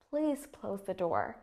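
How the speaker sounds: slow command, dropping on "door"; measured instruction with the pitch going down to "door"; falling intonation. Shown as a demonstration. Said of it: "my voice tone decreases towards the end".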